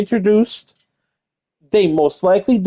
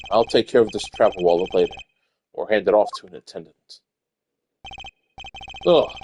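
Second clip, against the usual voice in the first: low, measured inner monologue; a composed prosecutor thinking aloud, low and calm; Miles Edgeworth; 9 semitones lower, 3 dB quieter than usual. Acted. Edgeworth musing to himself on the plane, low and composed.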